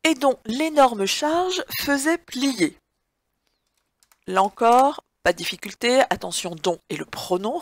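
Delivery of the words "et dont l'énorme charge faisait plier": slow, clear dictation reading; dictating. Shown as a demonstration. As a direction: read it out slowly for a dictée. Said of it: "dictée read to the class".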